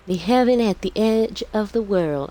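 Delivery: high-pitched Southern Belle; Southern Belle accent. Acted. Child's story title read aloud, in a Southern Belle accent. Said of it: "Southern Belle accent 'cause I'm imagining".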